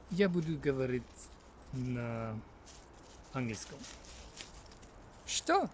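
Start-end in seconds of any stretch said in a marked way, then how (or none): 5.2-5.7 sudden high squeak of a question, half-joking; mock surprise. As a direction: play shocked at your own words. Mock surprise at own plan.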